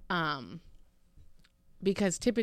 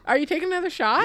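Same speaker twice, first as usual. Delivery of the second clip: surprised; 11 semitones higher, 9 dB louder than usual. Real, not acted. Reacting to partner's shot.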